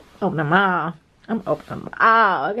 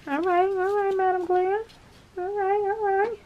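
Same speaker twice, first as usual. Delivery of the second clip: sing-song; 10 semitones higher, 5 dB quieter than usual. Real, not acted. Sing-song cheer to the brand.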